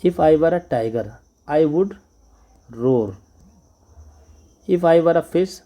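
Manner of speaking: slow, deliberate classroom recitation; slow rhyme reading. Shown as a demonstration. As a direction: read it out slowly for children. Reading the rhyme line slowly.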